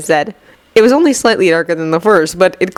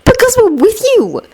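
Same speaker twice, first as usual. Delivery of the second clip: put-on high boyish voice; shy boy's reply; Neville voice. A narrator's reading.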